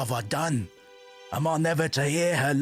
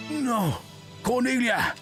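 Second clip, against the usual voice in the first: shocked lover, gasping and crying out; a gasp, then a horrified cry; 11 semitones higher, slower than usual. Acted. Lover sees Cornelia cut.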